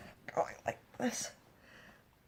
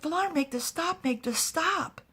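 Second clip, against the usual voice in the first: high-pitched acted plea; desperate pleading; 10 semitones higher than usual. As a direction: beg urgently with the voice raised high. Attacked woman begs for help.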